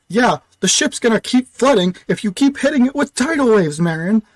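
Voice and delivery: high, loud, exasperated yell; yelling back. Acted. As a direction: shout it back over the chaos. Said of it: "as Leofier yells back".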